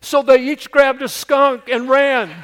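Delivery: loud, high preacher's punchline; full-force comic payoff; shouted punchline. A narrator's reading. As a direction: belt out the end of the story.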